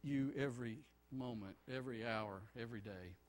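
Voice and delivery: soft, low earnest prayer; hushed pastoral prayer. Acted. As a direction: pray quietly to the Father. Leads the congregation in prayer.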